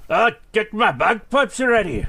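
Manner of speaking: high, slow Scottish brogue; mock Scottish accent. Co-host joking about a fossil from Scotland, mock Scottish accent. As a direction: ham up a bad Scottish accent. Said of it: "Oh, I can't do Scottish".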